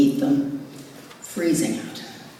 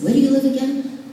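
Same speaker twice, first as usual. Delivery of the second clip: raised, louder retold question; questioning date; 11 semitones higher, 6 dB louder, faster than usual. Man asking his new date where she lives, questioning. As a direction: put on a curious suitor, higher and louder. Retelling date's question on first call.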